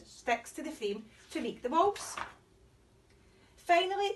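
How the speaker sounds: usual speaking voice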